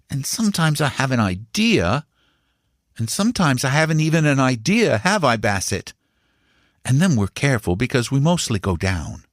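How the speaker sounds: put-on high, eager young boy's voice; a boy boasting to his uncle; boy Paul. A narrator's reading. Young Paul assures his uncle and Bassett, eager and high.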